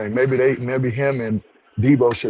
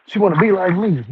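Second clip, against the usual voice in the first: put-on lightly raised, teasing voice of the boyfriend's mom; mocking the mother; 7 semitones higher than usual. Mom dismissing the rival she's jealous of, mock smug. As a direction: toss it off like a smug, amused mom. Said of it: "she was like".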